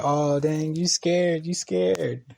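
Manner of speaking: high sing-out mock taunt. Landlord teasing her son to fight, taunting.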